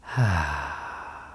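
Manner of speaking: long sighing exhale through the mouth; audible sigh. Shown as a demonstration. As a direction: breathe out with a sound, letting it trail away. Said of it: "breathe out through the mouth, making a sound".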